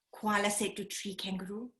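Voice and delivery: ordinary delivery, nothing marked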